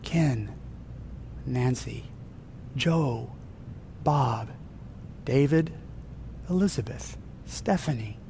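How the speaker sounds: hushed, slow roll call of names; calling names softly. Acted. God calling each member by name, hushed and tender. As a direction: say each name gently, apart. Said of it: "He calls you by name".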